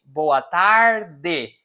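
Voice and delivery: "Boa tarde" ending in a clear "de"; slow "Boa tarde", final syllable said "deh"; southern Brazilian pronunciation. Shown as a demonstration. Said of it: "I am from the south of Brazil".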